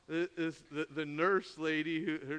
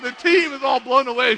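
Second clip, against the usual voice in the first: ordinary talk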